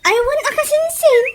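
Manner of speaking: high, eager lilt; chirpy high reply to a cousin; eager agreement. Acted. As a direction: agree brightly, pitch climbing.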